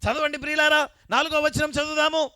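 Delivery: high, loud, slow scripture call; full-force preaching. Acted. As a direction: call the verse out over the congregation. Preacher leads scripture reading. (పెద్ద గొంతుతో వాక్యం చదవడం)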